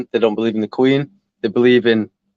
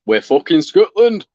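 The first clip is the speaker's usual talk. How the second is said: slow, higher, louder proud yell; mock Scottish shout; 6 semitones higher, 5 dB louder, slower than usual. Mimicking Scottish people shouting their pride, loud and slow. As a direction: bellow it like a proud nationalist. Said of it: "The Scottish people".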